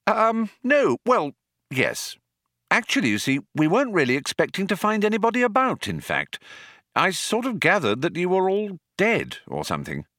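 high-pitched, awkward backtracking; flustered Arthur. A narrator's reading. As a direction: fumble through an embarrassed explanation.

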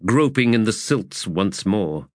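ordinary delivery

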